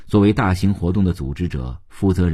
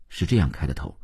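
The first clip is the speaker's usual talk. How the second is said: ordinary delivery, nothing marked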